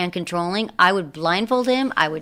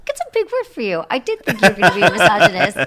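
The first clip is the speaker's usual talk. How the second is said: teasing; 2 semitones higher, 7 dB louder, faster than usual. Real, not acted. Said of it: "teasing co-host".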